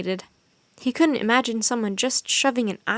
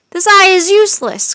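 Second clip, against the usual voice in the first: furious cry; 9 semitones higher, 11 dB louder than usual. A narrator's reading. Wizard cries out at Jack and Annie in fury. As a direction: cry it out much higher and louder. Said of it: "cried the wizard".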